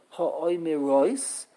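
ha'omerois with one stress, slow; the reading to avoid: ha'omerois; ha'omerois without stress on the aleph; wrong way. Shown as a demonstration. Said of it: "don't say".

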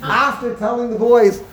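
high, loud, laughing retelling of a punchline. Real, not acted. Teacher retelling a Purim spiel's punchline, laughing. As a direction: crack up while hammering home the joke.

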